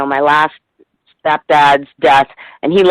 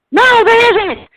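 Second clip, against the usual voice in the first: much higher mock mom voice, easing down at the end; imitating mom; 12 semitones higher than usual. Daughter imitating her mom on the phone in a much higher voice. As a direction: play a frazzled mom brushing off help, then sweet. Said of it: "And she's like".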